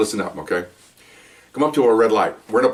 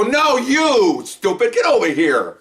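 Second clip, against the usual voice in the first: high, mock yell, slower on stupid get over here; 12 semitones higher, much slower than usual. Cop yelling at the suspect in the alley, high mock yell. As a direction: bark it at the dumb suspect, half joking. Cops yelling at confused man.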